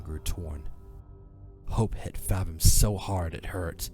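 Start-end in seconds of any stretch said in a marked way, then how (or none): none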